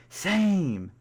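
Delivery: high, loud burst of excitement; excited shout. Acted. A squad mate finds his friend nearby, excited shout. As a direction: yell it out, thrilled. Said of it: "raise your voice in excitement and say".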